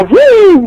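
high playful engine vroom; vroom sound effect. Pretend. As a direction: zoom it like a race car. Engine noise for fast connection.

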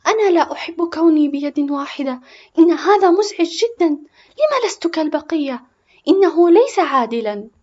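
put-on high, loud outburst of a hurt child; tearful shouting. A narrator's reading. Boy to his mother about having one hand, shouting tearfully. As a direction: cry out in protest at the unfairness. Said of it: "صرخ باكيا".

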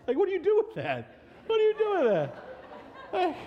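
high, loud laughing disbelief. Real, not acted. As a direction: ask it through laughter. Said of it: "now I'm laughing".

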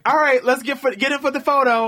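high cheerful group call; upbeat coworker. Retold or imitated. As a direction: round everyone up brightly. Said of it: "So they're like".

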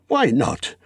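tentative, high wondering; light, self-persuading question; musing to himself. A narrator's reading. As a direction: try the idea out on yourself. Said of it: "he said softly to himself".

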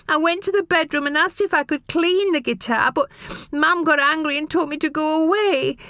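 put-on high, tearful boy's voice; sobbing. A narrator's reading. Boy sobbing to his father, high and tearful. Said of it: "Casey sobbed".

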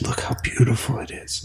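whispered in quiet awe; hushed whisper. Retold or imitated. Grandson retelling grandmother's awe at the street, whispered. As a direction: breathe it like you can't believe the view.

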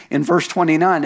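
usual speaking voice

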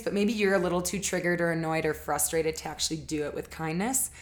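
ordinary talk

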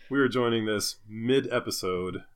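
ordinary talk, nothing marked

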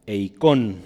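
"eikon" with the accent on the last syllable, KON; "eikon" pronounced as a taught word, end stressed; stress on final O. Shown as a demonstration. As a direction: land on the O of "eikon". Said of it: "con acento en la O".

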